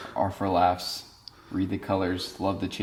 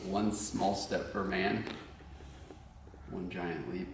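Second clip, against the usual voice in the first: quiet; 12 dB quieter, slower than usual. Shown as a demonstration. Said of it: "How's it go?".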